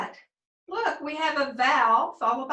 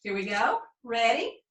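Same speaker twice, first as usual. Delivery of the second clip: usual speaking voice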